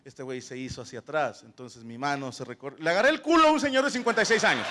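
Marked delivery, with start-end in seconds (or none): 2.8-4.7 fast, louder shouted punchline; embarrassed shout. Real, not acted. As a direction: blurt it out loud and fast, cringing. Punchline of embarrassing grab story.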